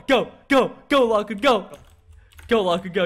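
punchy, repeated cheer; urging on. Real, not acted. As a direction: spur a friend on with each word. Urging a friend on.

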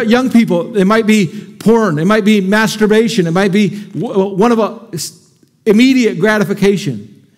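ordinary talk

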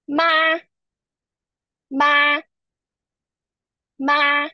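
MA high, long and level; first tone. Shown as a demonstration. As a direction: hold MA steady on a high note. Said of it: "it's first tone".

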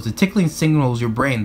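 ordinary delivery, nothing marked

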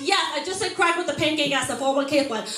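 usual speaking voice

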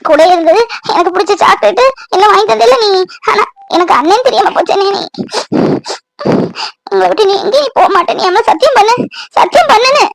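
tearful, high-pitched pleading; crying. Acted. Sister begs her brother to swear, crying. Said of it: "அழாத".

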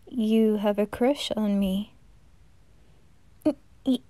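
ordinary talk

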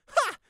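single loud, high "ha"; sharp burst of high derision; mocking laugh. A narrator's reading. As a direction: crow it triumphantly. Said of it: "Prey giggled harder".

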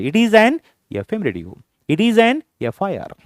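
slow and deliberate, each word spaced; slow teacher's demo; slow model sentence. Shown as a demonstration. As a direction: lay it out word by word for learners. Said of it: "काय घ्यायचं मागे? ॲन".

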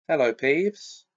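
ordinary delivery

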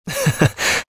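short, high, soft chuckle. Acted. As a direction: let out a small teasing laugh. Teasing about mischief.

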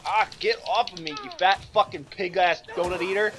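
loud, high tirade; angry insulting shout. Real, not acted. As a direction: spit the insults out. Grabbed by enemy.

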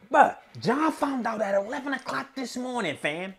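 high-pitched disbelief; stunned high voice; incredulous. Real, not acted. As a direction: squeak it out in shock. Outraged at Cassidy's late notice.

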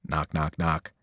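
usual speaking voice

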